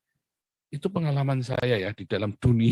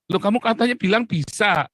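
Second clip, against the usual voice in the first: indignant boss; 8 semitones higher, 4 dB louder than usual. Acted. Boss confronting a worker who failed.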